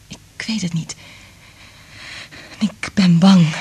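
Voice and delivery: fading, hushed whisper. Acted. Liz admits her doubt to Frank in a whisper. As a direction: trail off in a whisper. Fearful musing.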